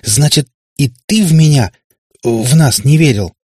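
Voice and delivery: loud, hurt accusation; a daughter accusing her father, indignant. A narrator's reading. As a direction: throw the betrayal back at him. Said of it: "задохнулась от возмущения".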